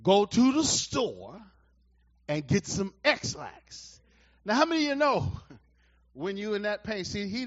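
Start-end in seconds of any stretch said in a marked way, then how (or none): 0.0-4.0 the Lord spells it out slowly and firmly; slow, deliberate divine command; slow, firm voice of God. Retold or imitated. God tells the sick preacher, slowly and firmly. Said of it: "this is what he said".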